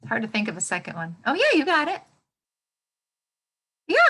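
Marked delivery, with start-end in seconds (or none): 1.3-4.0 excited praise. Real, not acted. Praising a student.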